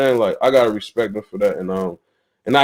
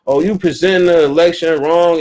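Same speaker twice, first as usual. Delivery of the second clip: higher, louder mimicked bickering; mocking argument; 5 semitones higher, 4 dB louder than usual. Mimicking arguments with friends over the election, mocking and higher. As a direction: imitate a squabble, drawing out the last sounds.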